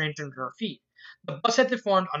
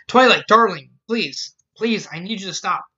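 urgent plea; 8 dB louder than usual. Acted. Rarity halting Twilight's panic.

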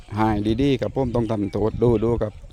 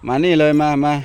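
loud, higher call to come; calling the dog; 5 semitones higher, 8 dB louder than usual. Real, not acted. Owner calling his dog to come here, loud firm call. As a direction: summon the dog sharply.

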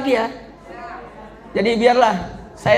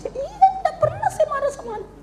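mother's voice; 4 dB quieter, faster than usual. Retold or imitated. Mother voiced answering preacher.

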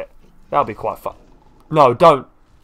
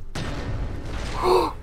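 sharp gasp; 9 dB quieter than usual. Real, not acted. Sudden gasp at game event.

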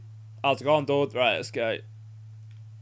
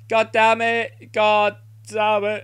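slow frustrated curse; 4 semitones higher, 3 dB louder, slower than usual. Real, not acted. Annoyed at missed chance.

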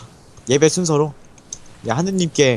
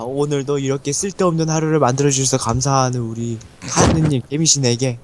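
prayer; 6 semitones lower than usual. Pretend. Leads a mock prayer.

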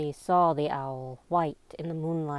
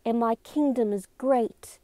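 higher-pitched, emphatic declamation; intense; 6 semitones higher, 3 dB louder than usual. A narrator's reading. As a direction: proclaim it with intense conviction. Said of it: "spoke with even more intensity now".

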